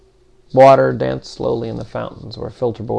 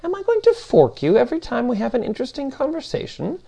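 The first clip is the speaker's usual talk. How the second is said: high, swooping exasperated question; put-upon machine being, pitch raised; indignant Demiurge; 13 semitones higher than usual. Acted. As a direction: object as if the request were absurd.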